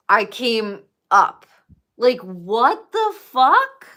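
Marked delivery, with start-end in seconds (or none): 2.0-3.9 slow, stunned what-the-fuck on waking; jolted awake and bewildered; startled awake cry. Retold or imitated. Waking from dream, shocked.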